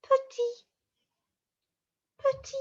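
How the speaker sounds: tiny high squeak; acting out small. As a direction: shrink the word into a little high voice.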